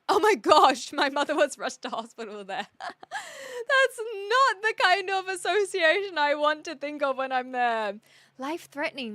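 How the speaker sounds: laughing through the story; half laughing, half crying. Real, not acted. Laughing about mother in hospital.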